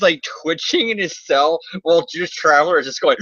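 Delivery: ordinary delivery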